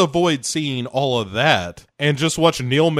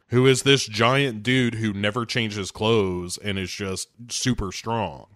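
ordinary talk

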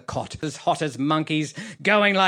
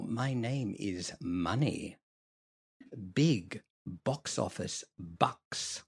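low sly boast; 6 semitones lower, 10 dB quieter, slower than usual. Acted. Villain boasts his true name.

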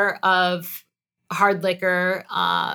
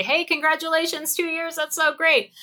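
cheerful congratulations; 9 semitones higher than usual. Retold or imitated. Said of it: "call me and say".

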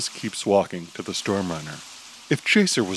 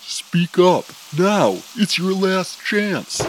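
loud, high, slow yell; 7 semitones higher, 5 dB louder, slower than usual. Acted. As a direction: shout across to your friend. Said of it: "He yells to his friend".

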